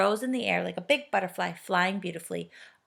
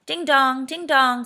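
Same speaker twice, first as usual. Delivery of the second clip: clock chiming; 6 semitones higher, 8 dB louder, slower than usual. A narrator's reading. The clock chimes.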